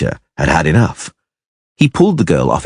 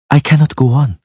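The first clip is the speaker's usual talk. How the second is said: usual speaking voice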